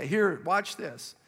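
ordinary talk

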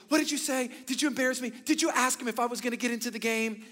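high, loud alarmed questions; upset sister. Retold or imitated. Embarrassed sister demanding what her brother said, high and loud. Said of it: "she goes".

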